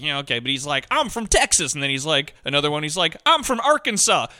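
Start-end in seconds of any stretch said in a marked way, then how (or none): 0.9-1.7 mocking the actor's fake Southern accent. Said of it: "he tries to do a Southern". 3.3-4.3 same pitched-up Southern parody; mocking an identical fake Southern accent. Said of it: "They sound the same".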